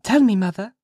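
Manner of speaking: put-on high, earnest child's plea; loud, high little-girl request; serious child. A narrator's reading. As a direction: ask mother in earnest, as a child. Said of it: "seriously coming up to Hester".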